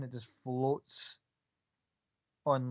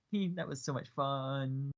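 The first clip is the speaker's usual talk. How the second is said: slow, giddy, lightly voiced girl; a hint of a bubbly girl still buzzing from the fun; bouncy excited girl; 4 dB quieter, slower than usual. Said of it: "standing still but bouncing a little bit, going".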